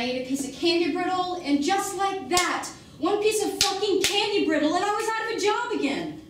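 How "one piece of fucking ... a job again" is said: raised, higher-pitched bitter tirade; angry outburst. Acted. Woman confronting Mikey about having no choices, angry outburst. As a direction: let frustration boil over, loud and high.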